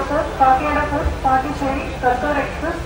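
ordinary talk, nothing marked

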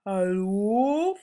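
sleepy bear. Acted. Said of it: "fragt der Bär verschlafen".

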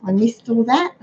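ordinary delivery

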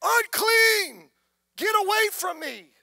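high, loud shouted warning; leper's warning yell. Retold or imitated. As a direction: shout to keep everyone away. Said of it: "his responsibility was to yell".

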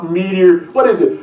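ordinary talk, nothing marked

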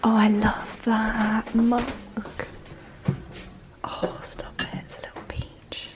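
hushed whisper among the shelves; shop whisper. Real, not acted. Browsing a quiet homeware shop, hushed whisper.

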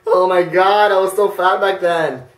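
ordinary talk

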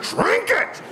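yelled high at a thrashing dog; mock yell. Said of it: "I'm like".

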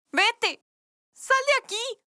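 rising angry send-off; angry dismissal. Acted. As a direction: drive him out of your life. Fátima drives Yamil away.

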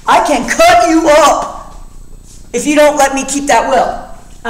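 high, slow mock threat; menacing threat. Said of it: "he's threatening him".

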